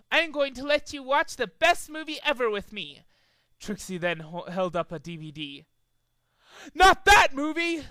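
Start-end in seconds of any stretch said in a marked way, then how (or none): 0.1-3.0 high mocking cheer; gleeful taunt. A narrator's reading. Trixie taunting Final Boss, high and gleeful. 6.7-7.8 high, loud horrified cry; pleading. A narrator's reading. Final Boss dreading the movie, high and loud. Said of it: "said Final Boss".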